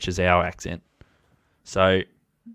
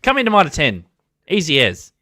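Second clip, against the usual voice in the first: Kiwi YouTuber impression; 7 semitones higher, 6 dB louder than usual. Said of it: "It's just".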